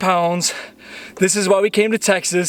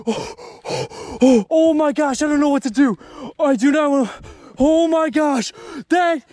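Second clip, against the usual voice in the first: high, breathless disbelief; overwhelmed; 5 semitones higher than usual. Real, not acted. Angler has just landed a huge bass, overwhelmed. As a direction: gasp out the exclamations, too stunned to finish.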